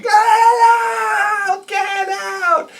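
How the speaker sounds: very high crying shriek, then fading; hysterical screaming. Retold or imitated. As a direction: scream it at your brother through tears. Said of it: "And then screaming, hysterical, crying".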